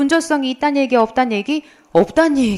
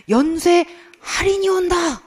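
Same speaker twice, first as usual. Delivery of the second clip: high, slow commercial announcer; TV ad imitation; 6 semitones higher than usual. Teacher recalling an ad that startled her, high announcer imitation. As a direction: proclaim it like a sale advert. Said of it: "이렇게 광고를 하는 거예요".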